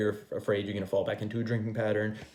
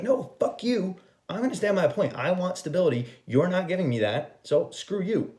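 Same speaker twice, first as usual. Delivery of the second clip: high-pitched, indignant mock tell-off; defiant rejection; 8 semitones higher than usual. Telling the in-and-out partner no, defiant. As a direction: act out putting your foot down, indignant.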